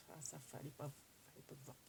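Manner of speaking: hushed aside. Real, not acted. Hiding a surprise from viewers.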